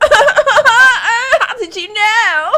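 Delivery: high wailing sob through laughter; crying. Acted. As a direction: wail it out tearfully. Leaves the elevator crying.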